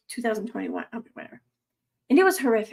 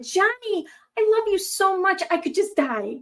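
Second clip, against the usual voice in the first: higher, starstruck fan voice; gushing fan; 8 semitones higher than usual. Retold or imitated. A fan meeting Johnny Depp gushes, starstruck and higher. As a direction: swoon over your idol.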